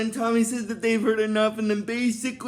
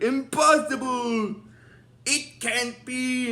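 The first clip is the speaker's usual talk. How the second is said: slow, drawn-out villain disbelief; Master Vile; 3 semitones higher, slower than usual. Retold or imitated. Imitating an agitated villain seeing his monster defeated, drawn-out. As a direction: stretch each word in mock outrage. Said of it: "Master Vile gets a little agitated".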